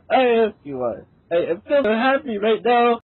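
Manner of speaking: high, tearful, overcome with joy; happy tears breaking into a wail; emotional crying. Acted. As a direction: sob out your gratitude, pitched high. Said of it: "[name] emotionally proclaimed".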